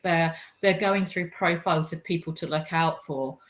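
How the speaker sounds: ordinary talk, nothing marked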